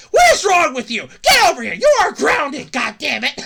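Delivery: loud, high angry scolding; scolding parent. Pretend. Parents scolding NFT investors, loud mock scolding. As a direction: yell like a furious parent. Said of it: "and go".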